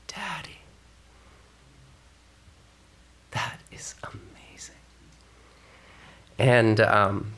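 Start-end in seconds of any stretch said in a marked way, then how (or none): 0.1-4.8 whispered, tender wonder; awed whisper. Real, not acted. Pastor to congregation, whispered wonder. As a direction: let it out on a breath of wonder.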